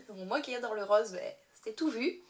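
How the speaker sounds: ordinary delivery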